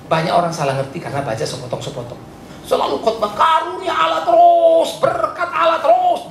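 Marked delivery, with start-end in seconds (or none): 2.6-6.3 high, slow mocking shout; mock preachers who only preach blessing. Pastor mocking one-sided preachers, slow high shout.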